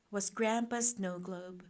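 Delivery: plain reading voice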